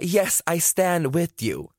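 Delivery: high, very slow mocking voice; imitating the au pair. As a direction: drag it out, high and earnest.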